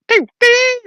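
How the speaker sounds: high-pitched ding noises; imitated bell. Storyteller imitating a bell as he goes in, high dinging.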